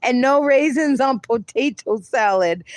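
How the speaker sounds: words through real laughter; laughing. Real, not acted. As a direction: giggle it out. Still laughing at chat.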